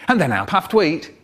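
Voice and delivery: plain reading voice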